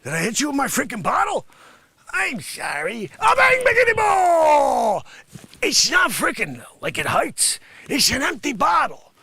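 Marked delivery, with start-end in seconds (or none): 3.2-9.1 high, loud mock rant; hammed-up angry tirade at Sonny; comic angry shout. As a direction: rant at him at full volume.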